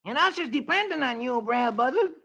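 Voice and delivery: high cartoon coon. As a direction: squeak it up like a little raccoon. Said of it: "And Brer Coon says".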